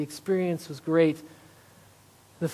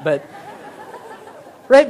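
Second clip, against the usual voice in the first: ordinary talk, nothing marked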